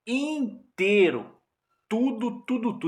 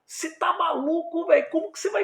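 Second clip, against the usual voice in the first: stunned disbelief; 13 semitones higher than usual. Retold or imitated. Friend still shocked.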